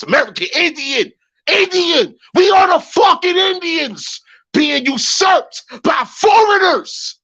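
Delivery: yelling it out, voice cracking with outrage; furious shout. Real, not acted. Streamer raging to his chat about being usurped, shouting. Heated rant building to a shout.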